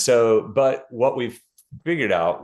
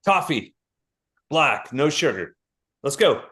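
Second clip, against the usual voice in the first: brash American tourist; 4 semitones higher than usual. Retold or imitated. An American in France ordering coffee, brashly. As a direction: bark an order like a rude tourist. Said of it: "go to France in an American accent".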